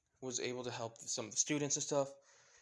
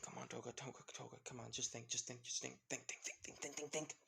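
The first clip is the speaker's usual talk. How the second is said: very soft, repetitive self-urging; thinking to herself; 10 dB quieter, faster than usual. A narrator's reading. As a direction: urge yourself on under your breath. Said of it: "she starts thinking, saying".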